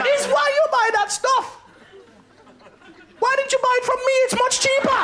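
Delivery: very high Jamaican woman, scolding; comic Jamaican cleaner in falsetto; old Jamaican cleaning lady. Retold or imitated. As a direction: squawk it in a high Jamaican accent. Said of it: "Old Jamaican cleaning lady".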